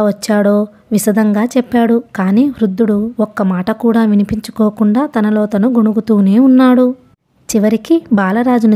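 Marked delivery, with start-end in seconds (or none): none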